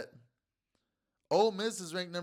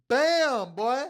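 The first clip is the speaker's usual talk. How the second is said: loud, high triumphant yell; excited shout; 9 semitones higher, 9 dB louder than usual. Real, not acted. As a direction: celebrate the big win. Celebrating winning bet.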